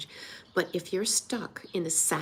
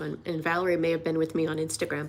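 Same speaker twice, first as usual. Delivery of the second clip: ordinary delivery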